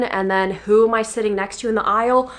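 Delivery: ordinary talk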